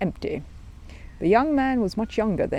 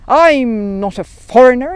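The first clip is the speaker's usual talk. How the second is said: louder, slow indignant bear; a stung bear protests to the policeman, loud and slow; hot protest; 5 semitones higher, 11 dB louder, slower than usual. Acted. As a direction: insist he is no foreigner, stung. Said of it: "exclaimed Paddington hotly".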